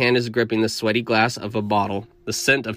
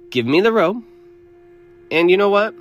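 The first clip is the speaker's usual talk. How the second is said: louder, higher greedy demand; cleric demand; 9 semitones higher, 5 dB louder than usual. A narrator's reading. Cleric raising his price, loud demand.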